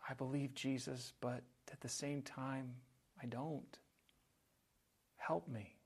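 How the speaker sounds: soft, hushed confession; a whispered prayer to Jesus; honest confession. Acted. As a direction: admit the doubt quietly and sincerely. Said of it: "just more honesty".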